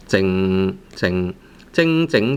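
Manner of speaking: "靜" drilled with a lingering nasal ng at the end. Shown as a demonstration. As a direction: hold the end of each "靜" in the nose. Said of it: "a lot of nasal sound at the end".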